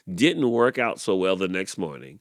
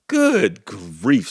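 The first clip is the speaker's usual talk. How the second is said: louder groan; exasperated horse; 6 dB louder than usual. A narrator's reading. Horse groaning at the failed crow, louder exasperation. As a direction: groan it, fed up.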